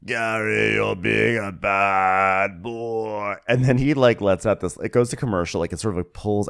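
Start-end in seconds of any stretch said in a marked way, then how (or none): 0.1-3.3 very slow, drawn-out mock scolding; teasing bad boy. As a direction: stretch each word like a naughty tease. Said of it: "and then he goes".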